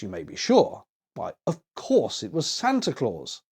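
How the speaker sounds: usual speaking voice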